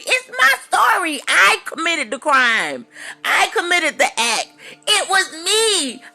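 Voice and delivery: defiant cry. Real, not acted. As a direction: shout it out, owning the guilt. Defiant shout at her critics.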